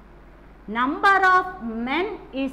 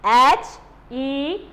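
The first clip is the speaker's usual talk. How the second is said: H E N C spelt out letter by letter; slow spelling for the class; much slower than usual. Shown as a demonstration. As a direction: spell it out slowly. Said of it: "What is the spelling of hence?".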